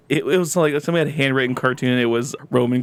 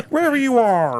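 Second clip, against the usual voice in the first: record slowing impression; 9 semitones higher than usual. Shown as a demonstration. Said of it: "stop like an old record".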